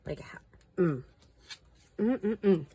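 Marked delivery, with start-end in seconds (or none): 2.0-2.7 disapproving hum. Real, not acted. Disapproving hum after story.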